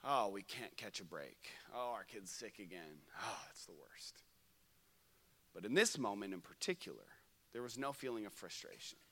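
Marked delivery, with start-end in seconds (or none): 0.1-4.2 lightly acted tired parent; a touch of exasperation, sinking low and soft; weary complaint. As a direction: sigh through each grievance.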